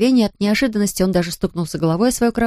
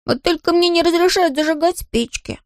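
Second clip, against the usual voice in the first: put-on higher-pitched rueful boy; upset; 8 semitones higher than usual. A narrator's reading. Bertel admits he may not light matches, upset. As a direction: add it with mild dismay. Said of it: "огорчённо добавил".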